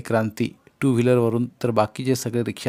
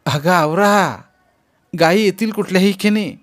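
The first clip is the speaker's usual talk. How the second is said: eager hurrying call; 7 semitones higher, 7 dB louder than usual. Acted. Mother hurrying everyone for cows.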